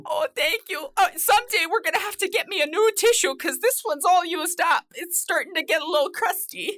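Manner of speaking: high, tearful comic voice; hammed-up weeping wife. Wife given a used tissue, comically tearful. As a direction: fuss through put-on tears, pitched high.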